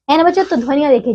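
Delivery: normal talking voice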